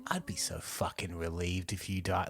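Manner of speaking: soft, low, sincere menace; deliberate, chilling read. Acted. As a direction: say it quietly as if truly meant. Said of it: "that's not her being like".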